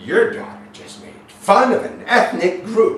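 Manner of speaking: loud high mock-indignant teacher. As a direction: squawk it like an outraged teacher. Imitating complaining teacher.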